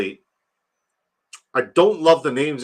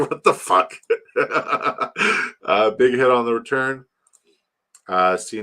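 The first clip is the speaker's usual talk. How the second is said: ordinary talk, nothing marked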